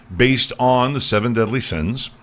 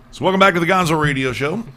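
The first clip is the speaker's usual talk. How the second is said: announcer welcome; 6 semitones higher, faster than usual. Acted. Host welcomes listeners back.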